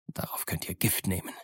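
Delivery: soft, whispered menace; hushed, menacing aside; quiet threat. Acted. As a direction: drop to a near-whisper for the threat. Captain's menacing closing threat.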